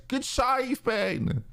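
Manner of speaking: high, mock-admiring praise; impressed onlookers. Imitating onlookers praising his save, high and mock-admiring. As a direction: chirp it up like an impressed fan. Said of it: "the people there with their human eyes say the phrase".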